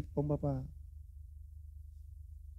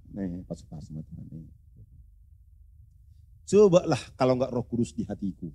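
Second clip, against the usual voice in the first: ordinary talk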